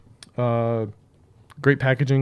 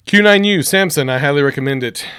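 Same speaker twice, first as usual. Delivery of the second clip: loud plug; 3 semitones higher, 10 dB louder than usual. Acted.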